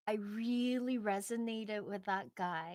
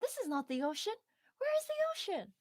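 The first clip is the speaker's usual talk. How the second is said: put-on eager young fish; 12 semitones higher, faster than usual. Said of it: "the young fish is like".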